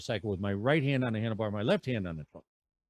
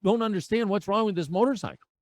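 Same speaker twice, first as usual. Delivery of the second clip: frustrated retold outburst, pitched way up; cussing and screaming; 10 semitones higher than usual. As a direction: blow up at the dead bike. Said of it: "I start to cuss and scream".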